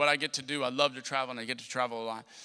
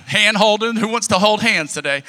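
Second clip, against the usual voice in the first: loud, higher mock-hawker call; gleeful calling out; 5 semitones higher, 9 dB louder than usual. Emboldened child offering friends hands, loud gleeful call. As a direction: crow it out to the whole bus.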